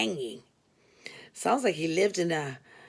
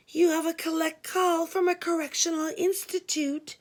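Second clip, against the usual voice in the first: operator voice; 9 semitones higher than usual. Retold or imitated. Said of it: "she said".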